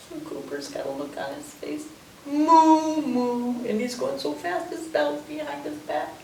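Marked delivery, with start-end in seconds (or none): 2.2-3.7 two drawn-out cow moos, raised in pitch; cow mooing. Acted. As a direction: moo like a speeding cow. Cow racing, voiced by reader.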